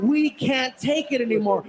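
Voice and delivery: ordinary talk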